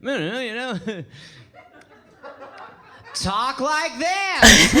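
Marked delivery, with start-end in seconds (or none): 3.1-4.7 put-on very slow, loud American accent. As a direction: drawl out a new American accent. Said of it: "just talk entirely differently".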